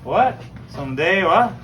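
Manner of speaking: Newfoundland misunderstanding. Acted. Listener not understanding a Newfoundlander, increasingly loud dialect exchange. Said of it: "Same conversation would have gone on like this".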